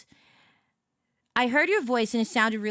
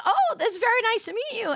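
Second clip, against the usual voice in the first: high delighted reply; 12 semitones higher, 3 dB louder, faster than usual. Retold or imitated.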